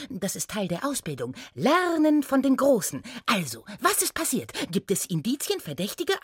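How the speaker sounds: lively, lightly high chatterbox; eager gnome apprentice. Acted. New intern to senior inspector, eager chatter. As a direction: rattle on with keen swings of pitch.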